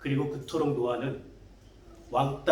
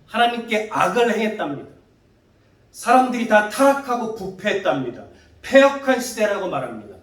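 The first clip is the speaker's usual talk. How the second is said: louder, higher emphatic preaching; rising preaching; 5 semitones higher, 5 dB louder than usual. Real, not acted. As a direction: drive the point home with force. Preacher stresses sin. (목소리를 높여 힘주어 설교하듯)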